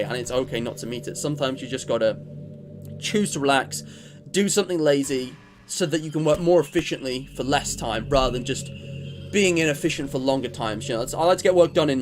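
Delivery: usual speaking voice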